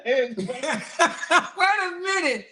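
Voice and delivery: drawn-out words through laughter; laughing. Real, not acted. Viewer stopping the clip in disbelief, laughing.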